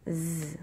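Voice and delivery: "z" as a long buzz, without its letter name; isolated sound. Shown as a demonstration. Teacher counting sounds for pupils, held "z". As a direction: sustain the buzzing "z" alone. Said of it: "On entend un premier rond pour".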